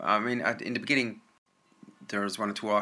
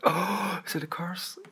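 high, gasping mock outcry; theatrical high-pitched oh; mock dismay; 9 semitones higher, slower than usual. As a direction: gasp it out high in mock shock. High mock exclamation amid story.

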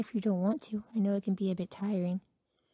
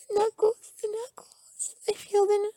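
high cry; 12 semitones higher, 4 dB louder than usual. Acted. Roleplay reaction to fingers.

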